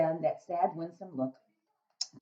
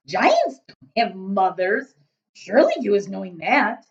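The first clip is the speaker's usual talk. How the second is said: loud, booming friendly giant; a big-hearted giant rumbling away; 4 semitones higher, 12 dB louder, slower than usual. Acted. BFG speaking.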